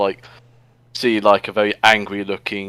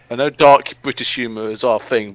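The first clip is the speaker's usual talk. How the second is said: ordinary talk, nothing marked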